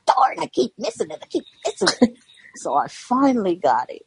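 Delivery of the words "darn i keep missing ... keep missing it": high, fast frustrated quote; exasperated. Retold or imitated. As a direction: whine it in mock frustration. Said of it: "I'm like".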